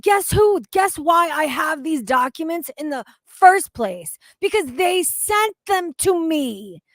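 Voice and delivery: loud, high indignant rant; exasperated rant. Real, not acted. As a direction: vent at the coworker who wasted your time. Angry rant about coworker's call.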